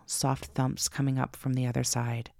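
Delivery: ordinary delivery, nothing marked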